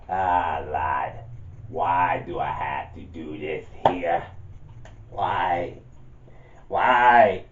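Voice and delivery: put-on low, gruff Irish accent with a shouted why; an Irish gang boss complaining in a deep Irish accent. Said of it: "he speaks in a deep Irish accent".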